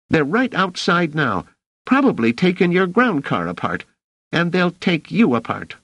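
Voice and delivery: put-on high, squeaky boy's threat; excited, high-pitched kid voice; threatening street kid. A narrator's reading. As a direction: warn him defiantly as a scrappy kid. Boy threatens officer with his gang.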